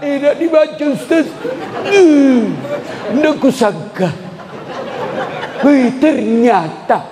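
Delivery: high, slow mock surprised woman; mock woman's voice. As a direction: gasp as the wife finds the messages. Woman retells reading husband's WhatsApp. (suara perempuan terkejut pura-pura)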